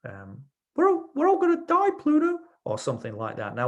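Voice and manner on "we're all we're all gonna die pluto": very high, squeaky cartoon mouse voice; a silly high-pitched cartoon mouse panicking; Mickey Mouse voice. Shown as a demonstration. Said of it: "say it in Mickey Mouse's voice".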